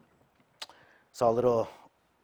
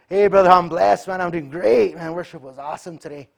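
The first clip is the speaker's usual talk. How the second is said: much higher, louder hearty hello; fake cheerful greeting; 8 semitones higher, 13 dB louder than usual. Brothers greeting at church, hearty mock cheer. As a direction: put on a blessed front, loud and bright.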